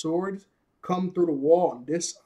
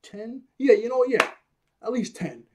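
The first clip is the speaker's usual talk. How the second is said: high, bouncy, eager; excited boast; 5 semitones higher than usual. Retold or imitated. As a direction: hype it up like a kid. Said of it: "Luffy goes".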